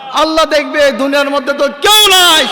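ordinary talk, nothing marked

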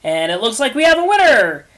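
hyped race announcer yell; announcer shout. Acted. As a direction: announce the winner to the crowd. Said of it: "Will shouted".

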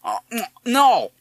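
talking through a mouthful; mumbled with full mouth. Acted. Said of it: "said Madison indistinctly".

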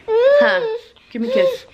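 cooing whisper. Real, not acted. Mom asking her toddler for a kiss, high whisper.

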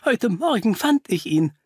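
high-pitched, distressed report; shaken Doctor Pickley. Acted. Pickley reports finding the body to Holmes, shaken. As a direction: pitch climbing towards a faltering halt.

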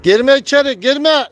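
high, loud warning yell; angry shout. Real, not acted. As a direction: stop it going in, shouting. Shouting a bird out of the coop.

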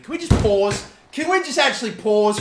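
real frustrated plea; exasperated plea. Real, not acted. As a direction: beg them to stop messing about. Begging the players to stop.

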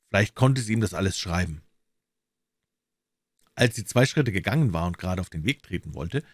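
ordinary delivery, nothing marked

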